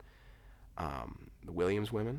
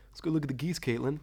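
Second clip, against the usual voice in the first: higher, louder, fake-casual suggestion; mock innocent brother luring her away; lame excuse voice; 9 semitones higher, 7 dB louder, faster than usual. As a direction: sell an obvious excuse badly. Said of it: "Trevor, like, made some lame excuse".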